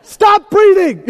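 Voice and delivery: high, drawn-out mock yell; mock furious wife. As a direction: yell it slowly, hammed-up exasperation. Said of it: "Now she's like".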